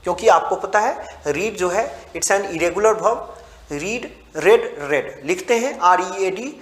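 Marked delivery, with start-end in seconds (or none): 4.4-5.2 रेड, the past tense, said like the colour red; रेड with a short clipped e; read as red. Shown as a demonstration. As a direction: say रेड with a short e, rhyming with bed. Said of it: "लेकिन उसको पढ़ेंगे रेड".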